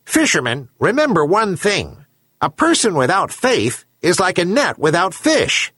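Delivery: high-pitched herring gull giving counsel; wise talking gull. A narrator's reading. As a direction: proclaim the moral in a high bird voice. Gull's parting speech to fisherman.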